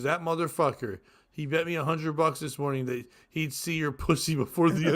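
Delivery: ordinary talk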